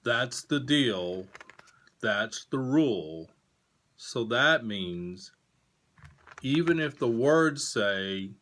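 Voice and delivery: slow, deliberate storybook read; reading to children. A narrator's reading. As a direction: read it to kids, slow and clear. Reading picture book aloud.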